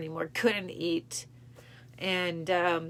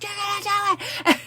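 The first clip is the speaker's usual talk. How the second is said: high, loud pushing yell; insistent shout; 8 dB louder than usual. Retold or imitated. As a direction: drive her on with a yell. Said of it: "He's like".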